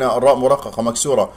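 ordinary talk, nothing marked

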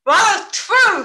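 ordinary talk

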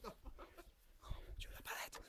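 breathless awed whisper. Real, not acted. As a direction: hush it in disbelief.

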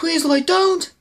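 high-pitched, worried begging; alarmed plea. Real, not acted. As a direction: plead with someone to stop. Pleading with character in trailer.

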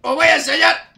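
high, loud threat; menacing shout. Pretend. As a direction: bark it as a warning. Threatening coworkers while smashing.

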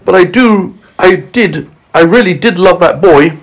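high-pitched, halting lament; acted tearful confession; storybook sobbing. A narrator's reading. Earthworm admitting love for James, acted sobbing.

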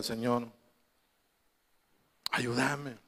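soft, low plea to God; retold prayer. Retells tearful prayer for help.

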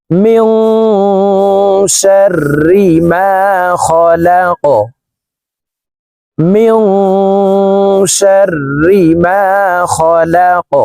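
slow, drawn-out chanted recitation; tajweed-style chant; Quranic recitation. Shown as a demonstration. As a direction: recite it melodically, stretching each vowel. Recites the verse.

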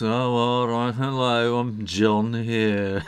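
put-on slow glum Birmingham accent; a cynical beetle in a Birmingham accent; drawn-out, depressed Birmingham voice. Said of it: "that's a Birmingham accent".

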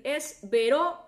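Vero said slowly and loud, each sound clear; slow spelled out. Shown as a demonstration. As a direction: dictate Vero slowly so it can be typed. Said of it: "te lo voy a repetir". (dictando Vero despacio y fuerte)

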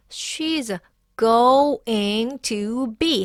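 "going to be" very slow, each syllable separate; four full syllables. Shown as a demonstration. Teacher counting syllables for learners, slow full "going to be". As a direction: stretch out "go-ing to be" as written. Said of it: "하면 음절이 네 개가 되는데".

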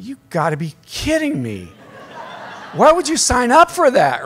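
mock-incredulous retort, pitch jumping high. Retold incredulous reply to brother.

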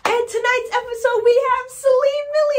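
high, loud host introduction; mock talk-show host. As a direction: announce her like a TV host. Imagined host announcing her.

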